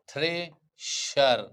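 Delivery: "Thresher" pulled apart into थ्रे and शर; slow syllable-by-syllable. Shown as a demonstration. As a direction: draw out "thresher" very slowly, pausing mid-word. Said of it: "इससे उच्चारण thresher". ("Thresher" को तोड़कर, बहुत धीरे बोलें)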